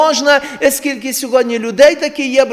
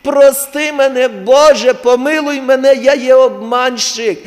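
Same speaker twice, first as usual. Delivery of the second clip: mock sinner's prayer; 4 semitones higher, 7 dB louder than usual. Mock confession prayer to God.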